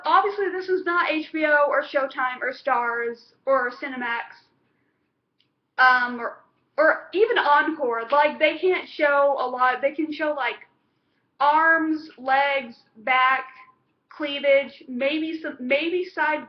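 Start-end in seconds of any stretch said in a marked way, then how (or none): none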